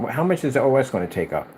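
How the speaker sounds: ordinary delivery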